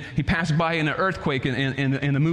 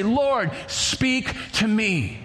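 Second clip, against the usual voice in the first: very slow prayer; quiet prayer; 4 semitones higher, much slower than usual. Pretend. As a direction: draw the prayer out slowly. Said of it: "clear your mind and say".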